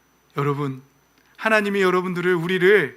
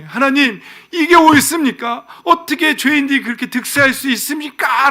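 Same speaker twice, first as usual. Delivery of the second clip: raised, high-pitched loud protest; complaining protest; 8 semitones higher, 7 dB louder than usual. Acted. Habakkuk complains to God in prayer, indignant protest. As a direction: cry out a grievance in prayer. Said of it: "기도하면서 막 불평해".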